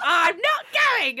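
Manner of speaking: high, loud, slow "I'm not going"; mock firm refusal. She refuses the holiday trip in a slow loud voice. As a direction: stamp it out like a final decision.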